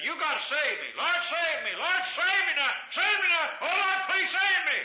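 high, loud, begging wail that keeps climbing; a desperate pleading shout rising to a peak; mock pleading cry. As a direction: imitate a sinner crying out for rescue. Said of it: "They down there and say".

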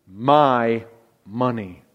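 "my" and "money" slow, low and weighty, each set apart by a pause; stress on my money. Shown as a demonstration. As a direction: lean hard on "my money", falling to a hush. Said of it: "notice these two words".

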